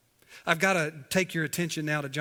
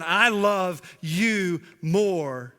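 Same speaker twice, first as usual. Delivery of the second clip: each word drawn out, loud, MORE stretched; emphatic boast; 3 semitones higher, 6 dB louder, much slower than usual. Retold or imitated. Peter boasting of his love for Jesus, slow and emphatic. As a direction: declare it slowly with heavy weight on "more". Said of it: "Peter is elevating his love".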